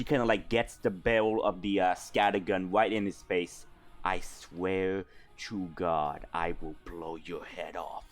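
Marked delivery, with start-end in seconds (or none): 4.0-8.0 low, quiet death threat; evil monologue. Acted. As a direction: growl the threat softly. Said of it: "your evil monologue".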